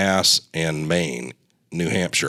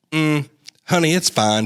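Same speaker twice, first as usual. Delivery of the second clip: Southern nurse voice; 9 semitones higher, 3 dB louder than usual. Retold or imitated. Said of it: "Maybe I didn't do the accent".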